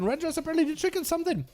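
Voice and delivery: high, urgent esports commentary; excited live match caster; play-by-play. Acted.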